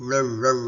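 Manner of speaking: loud, rolled double bark; dog bark imitation. Shown as a demonstration. Teacher explains how a dog barks, loud imitated bark. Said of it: "இப்ப நான் எப்படி குறைக்கும்".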